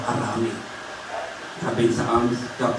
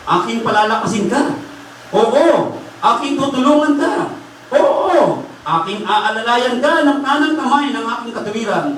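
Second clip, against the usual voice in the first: raised, higher-pitched sermon voice; impassioned preaching; 11 semitones higher than usual. Acted. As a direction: proclaim the promise to the congregation. Preaches the verse forcefully. (masiglang pangangaral na mataas ang boses)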